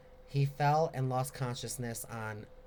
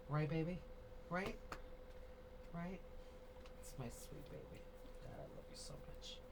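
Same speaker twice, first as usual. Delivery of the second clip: tender cooing; 5 semitones higher, 15 dB quieter than usual. Real, not acted. Comforting her sweet baby mid-cry, tender cooing. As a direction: murmur lovingly, fading to a near whisper.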